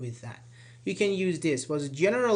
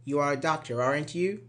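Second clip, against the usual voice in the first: "aren't you" rising as a real question; rising tag intonation. Shown as a demonstration. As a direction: lift the pitch on "aren't you", awaiting an answer. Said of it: "Rising intonation".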